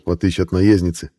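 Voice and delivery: ordinary talk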